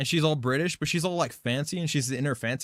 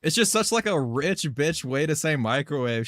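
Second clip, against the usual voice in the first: ordinary talk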